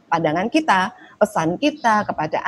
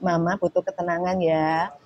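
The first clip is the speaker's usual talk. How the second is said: softer, gentle asking for quiet; calm request; 2 semitones lower, 4 dB quieter than usual. Shown as a demonstration. Mother gently asking her daughter for quiet, calm soft request. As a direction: ask kindly and softly, no blame. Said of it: "Sehingga kita ngomong".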